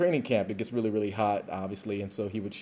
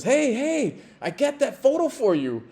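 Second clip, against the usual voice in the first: imitating an eager fan, pitched much higher and louder; excited fan; 11 semitones higher, 6 dB louder than usual. Fan runs up to the player with a photo, mock excited and much higher. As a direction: bubbly rush of a fan bringing a gift. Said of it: "And he's all excited and everything".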